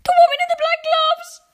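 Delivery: high, loud frightened yell; boy yells down to grandma about the witch; urgent shout. Acted.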